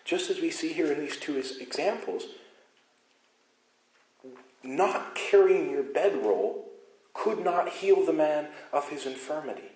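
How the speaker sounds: fiery sermon build; preaching at full force. Acted. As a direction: drive it home from the pulpit, rising and loud. Preaching climax on the law.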